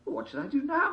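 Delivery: put-on girl voice. A narrator's reading.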